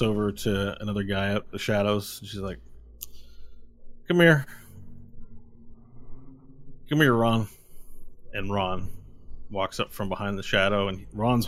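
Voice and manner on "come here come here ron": sharp call across the room. Acted. Villain calling henchman over.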